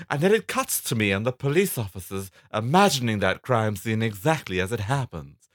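high start, hammed-up proud finish; Misty. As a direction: play a comic police lady explaining herself. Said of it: "This is a lady police character".

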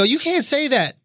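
high-pitched mock objection; triggered heckler. Triggered audience member objecting to a comic, mock outrage. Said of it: "If they go".

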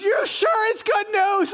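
high, drawn-out mock panic; frightened shepherd doubting the angel; freaking out. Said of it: "they're freaking out".

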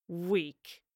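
quiet contemptuous spit of a word; spat. A narrator's reading. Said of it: "he spat".

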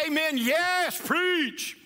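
high, loud, building church call; all-in combo response. Shown as a demonstration. Pastor demoing the all-in church response, high and loud shout. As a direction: shout all three like you mean it. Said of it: "kind of a combo of all of it".

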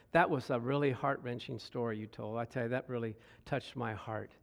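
telling the man his story moved her; soft heartfelt. Retold or imitated. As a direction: speak it with a touched heart. Speaker retold softly.

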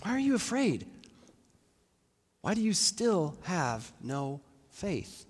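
disappointed questioning with long pauses; gently reprove, word by word; understated rebuke. Acted. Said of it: "rebukes them".

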